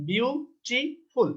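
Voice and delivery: Beautiful slowly split into three parts, biu-ti-ful; syllable by syllable. Shown as a demonstration. Teacher showing learners a three-syllable word, syllable by syllable. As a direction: break beautiful into its syllables with gaps. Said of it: "তিনটা".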